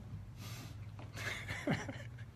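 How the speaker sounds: high unvoiced catch of breath; a real emotional catch in the throat; breath breaking with emotion; choked breathy sob-laugh. Real, not acted. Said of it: "without getting choked up".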